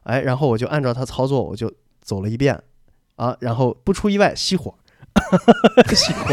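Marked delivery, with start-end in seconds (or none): 5.1-5.8 sudden high-pitched laugh; high burst of laughter. Real, not acted. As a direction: crack up at the memory. Laughing at own stalling. (突然高声大笑)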